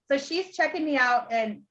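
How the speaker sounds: normal talking voice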